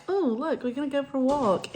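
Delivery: high, sing-song fake eagerness; mock chirpy. Imagined keen walker setting out, mock cheerful. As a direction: imitate someone thrilled at a walk. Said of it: "it's not like".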